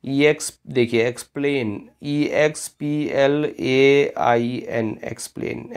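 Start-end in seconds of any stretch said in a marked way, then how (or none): none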